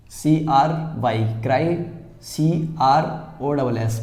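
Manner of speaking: सीआरवाई cry spelled letter by letter, very slowly; slow spelling out. Shown as a demonstration. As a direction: draw out each letter before the word. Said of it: "आधा किया, ओपी crop". (धीरे-धीरे अक्षर तोड़कर)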